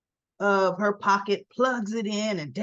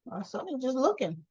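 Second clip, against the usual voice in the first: normal talking voice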